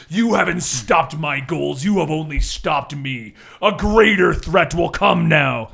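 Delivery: slow, booming villain threat; defiant dying villain. Acted. Game master voices Barbados defying the Justice League, slow and booming. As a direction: spit your last defiance at the heroes.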